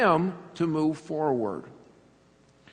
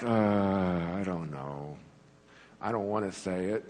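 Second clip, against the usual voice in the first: put-on reluctant child; 12 semitones lower, 8 dB quieter than usual. Child at the party refusing to say thank you, sulky. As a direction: drag it out like a kid who won't. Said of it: "then you're like".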